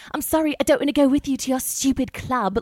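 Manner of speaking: high, exaggerated mean British accent; obnoxious British accent. Tourist turns down pushy club guys, obnoxious British accent. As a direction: put on an obnoxiously British brush-off. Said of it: "So then I was mean about it".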